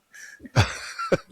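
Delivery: breathy high giggle; laughing. Real, not acted. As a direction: burst into a giggle. Laughs at own accent joke.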